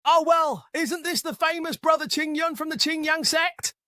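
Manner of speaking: high, sneering fake welcome; mocking. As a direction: sneer with mock politeness. Mocking rival greets him.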